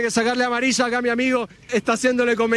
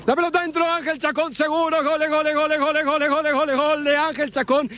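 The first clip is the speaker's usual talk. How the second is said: higher, rapid gole gole sportscaster call; excited goal call; 4 semitones higher than usual. Acted. Commentator calling a taco penalty goal, excited high. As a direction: ride the goal like a radio commentator.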